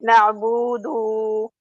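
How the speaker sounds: na'budu with bu and du both dragged out; wrongly prolonged. Retold or imitated. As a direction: stretch the last two vowels of na'budu. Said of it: "No, it's wrong".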